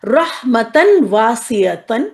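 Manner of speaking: رحمتن واسعتن drawn out slowly; careful recitation. Shown as a demonstration. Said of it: "اسی طرح". (آہستہ تجوید کے ساتھ)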